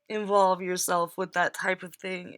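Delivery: usual speaking voice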